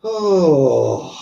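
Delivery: loud open-mouthed sigh out; long exhaling sigh. Shown as a demonstration. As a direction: let the breath go with a voiced oh. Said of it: "I'm exhaling".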